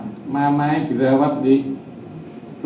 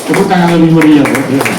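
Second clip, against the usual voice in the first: loud call for bigger applause; rallying the congregation; 10 dB louder than usual. Real, not acted. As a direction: whip up the crowd's clapping. Mengajak jemaat bertepuk tangan. (seruan lantang mengajak tepuk tangan)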